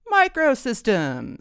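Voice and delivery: high, loud, drawn-out tipsy mockery; tipsy, shameless. A narrator's reading. Tipsy dad echoes the boyfriend's major, shameless. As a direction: stretch the word out like a tipsy dad. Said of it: "feeling no pain and no shame".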